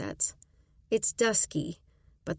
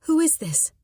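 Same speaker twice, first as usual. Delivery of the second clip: frightened; 9 semitones higher, 5 dB louder than usual. Acted. Frightened reply to anonymous caller.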